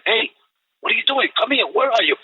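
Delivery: high, insistent call to the dog; retold summons to a straying dog. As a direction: call the dog over, pitch up without shouting. Retold calling to his found dog.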